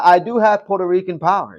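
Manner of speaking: ordinary delivery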